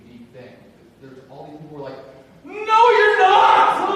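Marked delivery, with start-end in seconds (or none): 2.5-3.9 very high, drawn-out mock yell; outraged denial. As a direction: scream the contradiction, stretching every word. Mocks people denying others' identity.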